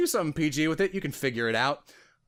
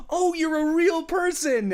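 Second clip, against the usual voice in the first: excited relief; 4 dB louder than usual. Retold or imitated. Reviewer's relieved thought.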